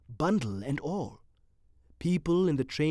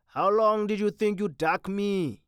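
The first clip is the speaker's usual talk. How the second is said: taunting thug; 6 semitones higher, 4 dB louder than usual. Acted.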